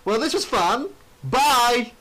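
high, falsely cheery sign-off; mock executive ending an awkward call; Dixie Carter. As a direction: put on a sing-song goodbye. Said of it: "it's Dixie Carter".

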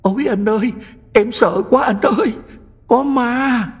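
high, tearful wail of terror; sobbing in fright. A narrator's reading. Terrified sister sobbing to her brother about a ghost, high tearful wail. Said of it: "nói trong tiếng khóc sụt sùi".

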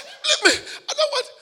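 high, loud refusal; hammed-up high refusal; girl refusing. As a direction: push him away with the words. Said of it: "I said".

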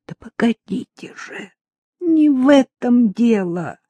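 высоко, сперва почти шёпотом, потом протяжно; останови её через силу; страдальческая мольба. A narrator's reading. Мучительно пытается остановить Любку. (измученно, с нажимом)

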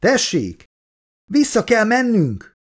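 high-pitched urgent exclamation; urgent call. A narrator's reading. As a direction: insist on turning back now. Urgent exchange after shouted call. (magas hangú, sürgető felkiáltás)

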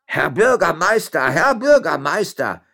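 slow, high, loud cries; calling out. A narrator's reading. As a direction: call for the master in drawn-out alarm. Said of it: "rief in diesem Augenblick Tonuelo".

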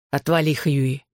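understated irritated brush-off; snapped back. A narrator's reading. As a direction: snap back without raising the voice. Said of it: "огрызнулся Джонни".

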